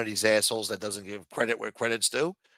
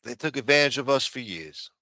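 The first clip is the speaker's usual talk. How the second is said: usual speaking voice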